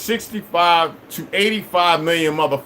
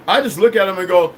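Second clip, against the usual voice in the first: ordinary talk, nothing marked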